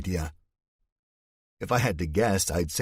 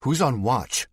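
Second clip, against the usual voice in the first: sharp, louder, higher demand; angry; 6 semitones higher, 4 dB louder than usual. Acted. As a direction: snap the question out in anger. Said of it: "feeling that anger bubbling".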